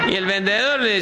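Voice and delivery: normal talking voice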